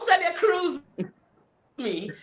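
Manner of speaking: ordinary delivery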